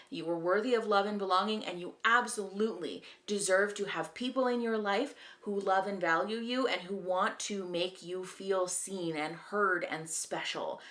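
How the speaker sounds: ordinary talk, nothing marked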